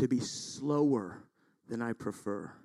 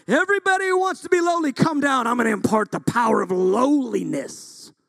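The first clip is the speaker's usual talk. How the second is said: high, booming revival-preacher shout; over-the-top preacher roar, stretched at the end; hammed-up altar call; 11 semitones higher, 9 dB louder than usual. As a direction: call the whole room forward at full volume.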